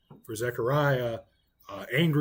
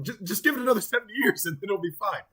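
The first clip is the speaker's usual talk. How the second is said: higher and quicker, a breezy offhand brush-off; mock casual angel; 7 semitones higher, faster than usual. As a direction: toss it off like a dismissive shrug. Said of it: "He doesn't say, "Oh, well, like".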